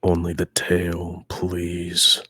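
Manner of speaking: slow, softened plea under the breath; mumbling to himself. Acted. Player voices Magnus watching the moons, a slow mumbled plea. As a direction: murmur it slowly, just to yourself. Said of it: "he just mumbles to himself".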